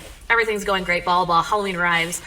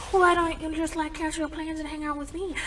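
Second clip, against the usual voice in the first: mock-sweet coaxing in a raised voice; wheedling dad; 9 semitones higher than usual. Dad pleading for a date.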